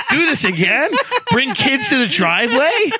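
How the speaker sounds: imitated astonishment, on the edge of laughter; incredulous echo. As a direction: repeat it back in retold disbelief. Acts out his incredulous reply.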